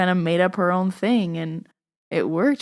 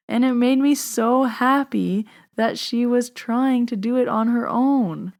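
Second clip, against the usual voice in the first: ordinary delivery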